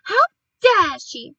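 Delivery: high, loud, slow indignation; outraged cry. A narrator's reading. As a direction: drag out each word in loud outrage. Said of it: "cried Missus Gregg as she flew".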